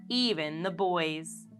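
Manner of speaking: plain reading voice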